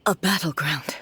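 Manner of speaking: hushed, breathy whisper. Acted. Archon naming the cave as a battleground, whispered. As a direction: breathe it out barely voiced.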